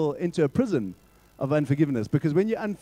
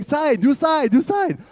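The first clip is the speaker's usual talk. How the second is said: put-on Chinese accent; 9 semitones higher, 4 dB louder, slower than usual. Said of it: "clipboard going".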